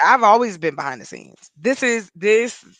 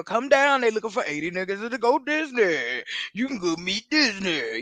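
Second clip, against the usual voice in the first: radio ad voice. Retold or imitated. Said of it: "them ads at the radio".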